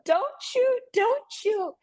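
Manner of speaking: high pleading cry; terrified scream. Retold or imitated. Girls with hands up pleading with police, terrified. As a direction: beg them not to fire, panicked. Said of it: "girls are screaming".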